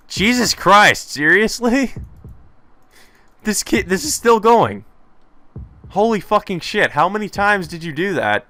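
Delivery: high, incredulous outburst; exasperated disbelief. Real, not acted. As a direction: exclaim in high-pitched disbelief. Reader's disbelief at repeated text.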